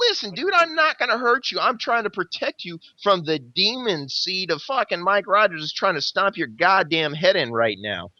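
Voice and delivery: acted-out insistent plea, pitch starting high and easing down; urgent retold plea. Fighter tells the pinned man he's protecting him, urgently.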